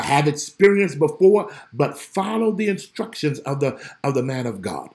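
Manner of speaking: ordinary delivery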